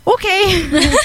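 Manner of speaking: high-pitched okay breaking into a laugh; giddy acceptance. Retold or imitated. Accepting the crush's prom invitation, giddy and laughing. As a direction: act out a giddy yes, voice jumping up.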